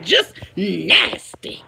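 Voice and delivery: slow whisper, "Just nasty" breathed out unvoiced. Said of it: "And I'ma say it just like that".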